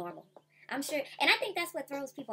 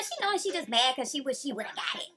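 ordinary talk

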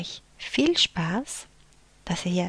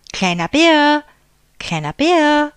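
loud, high repeated call; 9 semitones higher, 10 dB louder than usual. A narrator's reading. Big bear calling for little bear again, loud call. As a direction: call him twice, searching. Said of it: "rief der große Bär".

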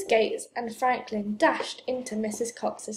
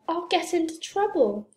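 higher-pitched worried protest; anxious, raised-pitch protest; worried cry; 6 semitones higher than usual. A narrator's reading. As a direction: cry it out with mild alarm. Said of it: "he cried".

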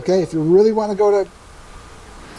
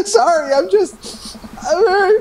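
high, slow, tearful voice fighting sobs; a father too moved to get the words out; choked up; 12 semitones higher, slower than usual. Acted. A dad crying as his daughter grows up, choked up.

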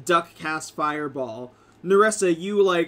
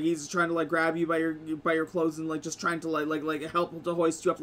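ordinary talk, nothing marked

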